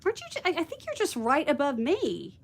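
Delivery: usual speaking voice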